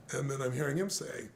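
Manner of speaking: usual speaking voice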